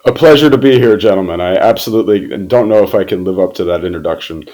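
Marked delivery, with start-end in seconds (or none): 0.0-1.4 high, loud cheerful hello; hearty greeting. Real, not acted. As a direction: greet them with big enthusiasm. Greeting hosts warmly.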